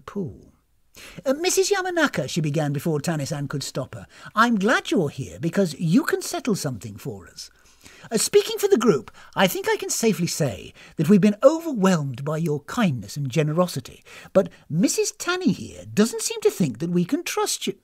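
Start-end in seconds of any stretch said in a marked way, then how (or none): 1.2-2.3 high-pitched polite call; eager guest. Acted. Guest addressing hostess before companion interrupts, eager. 4.3-17.8 higher, effusively polite voice; loose-lipped, earnest guest. Acted. Guest tattling to hostess about distrustful companion, effusive.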